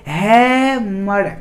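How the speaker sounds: Hammer drawn out very slowly; slow demo. Shown as a demonstration. Teacher slowly says hammer for students to copy. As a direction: stretch hammer to show its sounds. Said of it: "hammer उच्चारण कीजिए".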